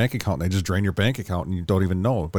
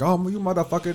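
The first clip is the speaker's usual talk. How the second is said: mock cussing; 9 semitones higher than usual. Retelling a scammer's reply to the hacker, mock cussing. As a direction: imitate someone cussing out a hacker, pitched high. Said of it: "swearing and cussing at the guy".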